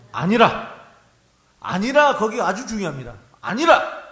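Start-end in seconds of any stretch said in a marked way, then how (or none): 0.1-0.8 크게 딱 잘라 외치듯; 힘주어 거절하듯 크게; 단호한 외침. Acted. 엘리사벳이 단호히 거절. 1.6-2.2 크게 딱 잘라 외치듯; 힘주어 거절하듯 크게; 단호한 외침. Acted. 엘리사벳이 단호히 거절. 3.4-4.0 크게 딱 잘라 외치듯; 힘주어 거절하듯 크게; 단호한 외침. Acted. 엘리사벳이 단호히 거절.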